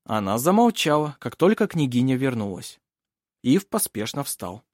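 plain reading voice

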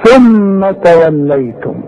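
ثم with its م humming for two beats; nasal ghunna. Shown as a demonstration. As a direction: hold the ghunna on ثم. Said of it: "يعني مثلًا إيه".

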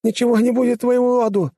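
high, loud desperate lisp; pleading lisp. A narrator's reading. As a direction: beg for mercy through broken teeth. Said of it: "вещал он".

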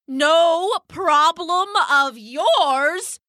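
very drawn-out, high indignant screech; screeched outrage. A narrator's reading. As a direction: stretch each word into a screeching, outraged question. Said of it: "screeched the dove".

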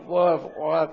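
normal talking voice

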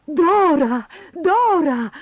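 two high, urgent calls; excited shout. A narrator's reading. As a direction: call out to Dora from afar. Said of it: "gridò". (grido eccitato, voce acuta)

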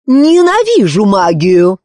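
loud, high, drawn-out hatred; queen's furious outburst; enraged shout. A narrator's reading. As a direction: lose your temper and shout. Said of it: "выйдя из себя, закричала королева".

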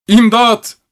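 çok tiz, yüksek bir imdat çığlığı; şiddetli haykırış. A narrator's reading. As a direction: var gücüyle yardım iste. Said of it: "şiddetli bir sesle". (müzisyen kapıda, avaz avaz imdat çığlığı)

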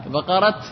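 بقرة said as baqarat with a final t and rising; pausal t ending. Shown as a demonstration. Teacher explains the joke, voicing بقرة with a final t. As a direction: end بقرة on a clear t, tune lifting. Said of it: "فوقف بالتاء".

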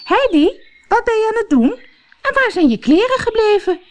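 mild mock scolding call. A narrator's reading. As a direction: call the child back from afar. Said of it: "haar tante Deti roepen".

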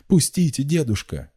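very high, loud plea; pleading cry. A narrator's reading. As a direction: cry out to be let through. Girl struggles past her grandfather.

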